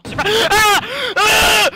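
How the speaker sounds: high piercing scream, cut-off word; terrified scream. Real, not acted. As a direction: scream at a jump scare. Jump scare in horror game.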